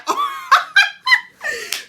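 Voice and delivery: sudden high, loud laughing burst; outburst of laughter. Real, not acted. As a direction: explode into a laugh you can't hold in. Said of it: "I had to do that outburst".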